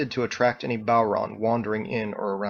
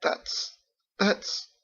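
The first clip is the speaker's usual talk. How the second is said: stunned murmur; 9 semitones higher, 4 dB quieter than usual. Acted. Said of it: "Captain said".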